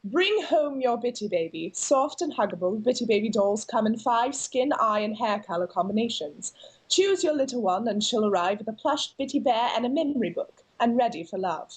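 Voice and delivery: received pronunciation, crisp and proper; upper class British. Shown as a demonstration. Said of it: "That's like upper class British".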